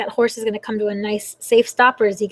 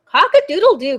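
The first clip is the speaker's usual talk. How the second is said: higher-pitched storybook crow; crowing rooster; 5 semitones higher, 7 dB louder than usual. Acted. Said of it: "crowed Rooster".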